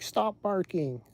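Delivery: half-yelled, exasperated owner impression; imitated yelling. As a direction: mildly mimic an owner scolding a dog. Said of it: "they just keep yelling at it".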